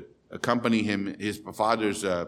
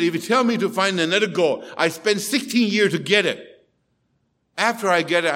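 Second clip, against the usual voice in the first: raised, higher, louder despairing outburst; agitated protest; 6 semitones higher, 5 dB louder than usual. Retold or imitated. Frustrated doctor protesting to the psychiatrist, raised and agitated. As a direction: push back hard, voice climbing in frustration.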